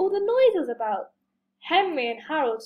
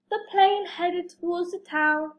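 plain reading voice

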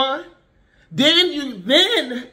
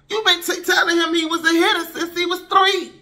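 higher, raised scolding voice; indignant outburst; 6 semitones higher than usual. Real, not acted. Mother scolding parents who praise their hitting toddler, indignant raised voice. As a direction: call the parents out with heat.

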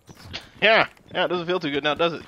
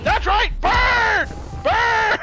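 gloating shout; 9 semitones higher than usual. Real, not acted. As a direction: crow over the kill. Gloating after a kill.

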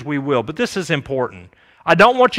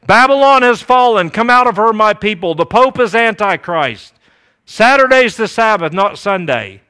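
loud, raised stock-answer recital; punchy slogans fired out in a raised voice; mock proclamation; 3 semitones higher, 5 dB louder than usual. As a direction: parody believers declaiming their doctrine. Mimics stock answer of most Adventists.

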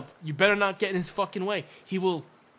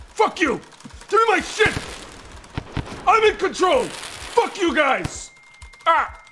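screaming in rage at the enemy; furious shouting; 9 semitones higher, 7 dB louder than usual. Real, not acted. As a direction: yell it, high and loud, out of control. Raging at creatures in game.

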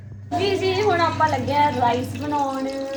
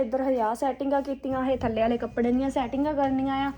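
ordinary delivery

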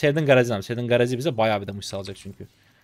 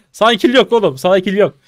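loud, higher taunting jeer; gloating taunt; 7 semitones higher, 11 dB louder than usual. Real, not acted. Streamer taunts the enemy who missed the kill, loud jeering. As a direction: rub the missed kill in his face.

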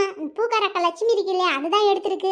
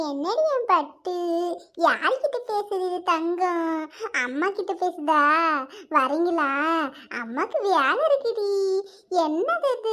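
slow, soft sing-song cooing; baby talk; 4 semitones lower, 3 dB quieter, slower than usual. Acted. Mother fussing over her baby, cooing baby talk. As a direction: coo to a baby in long, swooping words.

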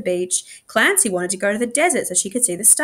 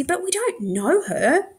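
put-on childish protest; 10 semitones higher, slower than usual. A narrator's reading.

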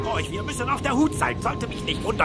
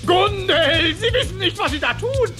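high, alarmed appeal; urgent warning; 7 semitones higher than usual. Acted. Uncle to Gundel holding the harp, urgent warning. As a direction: call out to stop the witch.

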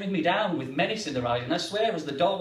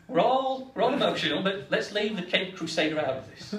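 usual speaking voice

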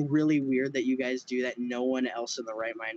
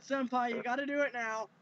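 mock anime girl; 9 semitones higher, 6 dB quieter than usual. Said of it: "She even said".

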